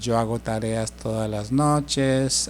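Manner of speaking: slow, clear model sentence; slow careful Spanish. Shown as a demonstration. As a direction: spell it out slowly for learners. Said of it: "I do homework every night".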